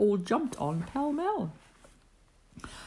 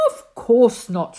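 louder, slow, kindly yes; smiling reply; 3 semitones higher, 8 dB louder than usual. Acted. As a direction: glad to help, slow and warm. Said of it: "smiled Thidwick".

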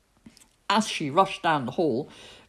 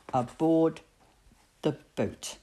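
ordinary delivery

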